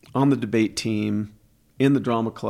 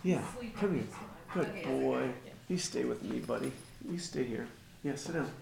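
soft coaxing pet talk; 2 semitones higher, 10 dB quieter than usual. Real, not acted. Soothing the dog beside her.

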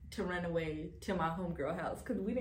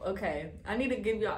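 ordinary delivery

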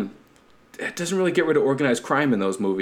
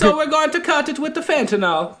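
very high-pitched Mario-style mobster; cartoonish Italian; 4 dB louder than usual. Host retrying the mobster line, cartoonish high Italian. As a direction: squeal it in a hammed-up cartoon Italian accent. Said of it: "it was too cartoonish".

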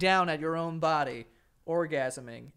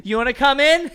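laughing mock invite; 9 semitones higher, 11 dB louder than usual. Mimicking the cab girls' invitation.

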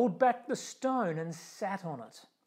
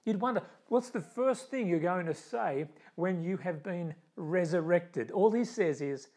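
ordinary talk

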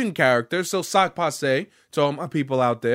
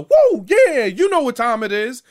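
high, loud nineties hype-DJ caricature; Lucio; 8 semitones higher, 7 dB louder than usual. Retold or imitated. Mocking the game's DJ character, high loud caricature. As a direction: whoop like an over-the-top party DJ. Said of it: "like, nineties caricature".

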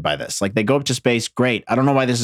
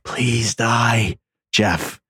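whispered plea; mock whisper; much slower than usual. Wife secretly wishing Jeff dead, mock whisper. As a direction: whisper a wicked little prayer.

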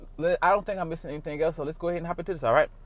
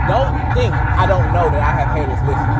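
loud call-out; 10 dB louder than usual. Real, not acted. Calling out the haters.

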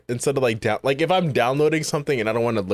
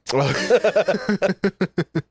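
high laugh; 7 semitones higher than usual. Real, not acted. Laughs at gifs joke.

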